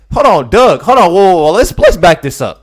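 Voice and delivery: shouting at the video to stop; loud hold on. Real, not acted. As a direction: halt it loudly in disbelief. Stopping the clip in disbelief.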